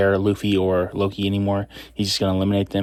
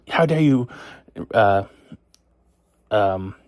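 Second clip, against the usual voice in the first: angry king; 2 semitones higher than usual. Retold or imitated. King scolding Brooks for rejecting his daughter, mock outrage. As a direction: scold like an offended father. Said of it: "And then he's, like, s-, angry".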